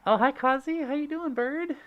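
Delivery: high, loud gushing hello; squealed happy hello; excited greeting. Real, not acted. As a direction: greet a dear friend with delighted high pitch. Greeting a friend in chat.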